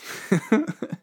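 high giggle; short burst of high-pitched laughter; self-amused laugh. Real, not acted. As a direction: laugh at your own boast. Laughs at own conceited boast.

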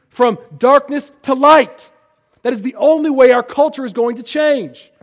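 swelling into full-voiced preaching; rising preaching fervor. Real, not acted. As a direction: preach it up to a crescending climax. Preacher builds to a climax.

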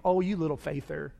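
put-on softly teasing, like a five-year-old ribbing you; gentle childish tease. A child teasing a parent, softly teasing. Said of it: "Almost teasing you".

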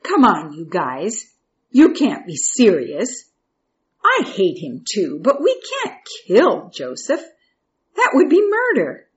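put-on alarmed older brother, pitch leaping up by the end; Reuben. Reuben protesting to his brothers about killing Joseph, alarmed. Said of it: "But Reuben, Jacob's oldest son".

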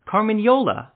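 loud, high-pitched cry of recognition; blurted out. A narrator's reading. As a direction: blurt the name out loudly in astonished recognition. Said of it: "one of the Nilfgaardian officers blurted out".

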